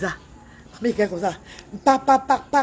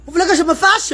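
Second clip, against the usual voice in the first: high, louder threat; 6 semitones higher, 4 dB louder than usual. Acted. Threatening the government.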